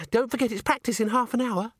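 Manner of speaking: high, sing-out parting line; chirpy reminder. A narrator's reading. Christine reminds Agnes of practice before skipping off, chirpy. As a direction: chirp it over your shoulder.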